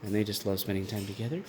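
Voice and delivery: ordinary talk, nothing marked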